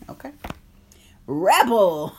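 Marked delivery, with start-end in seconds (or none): 1.3-2.1 excited reveal. Real, not acted. Reading the drawn card aloud.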